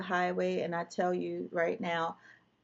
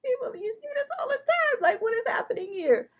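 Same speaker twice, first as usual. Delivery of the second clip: real tears breaking through high, louder words; crying; 4 dB louder than usual. Real, not acted. Mother reliving the night her daughter was shot, crying. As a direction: voice breaking into sobs.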